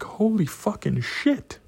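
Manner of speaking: high, slow, stunned exclamation; stretched-out mock horror; acted shock. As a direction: draw it out high in disbelief. Acting out his horrified discovery.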